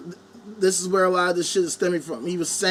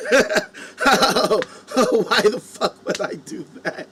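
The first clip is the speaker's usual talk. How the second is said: laughing through words; much slower than usual. Real, not acted. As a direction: laugh it off as absurd. Laughs at own idea.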